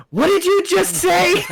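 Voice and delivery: high, squawked challenge; mock outrage. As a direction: screech it as if you misheard an insult. Retold outrage of team lead.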